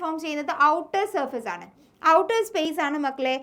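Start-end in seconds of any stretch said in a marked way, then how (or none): none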